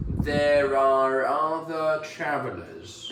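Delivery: There are other travelers, slow, with the R of are linked into other; linking R demo, slow. Shown as a demonstration. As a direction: sound the R in are before other, slowly. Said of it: "we often actually pronounce that R sound at the end of the word are".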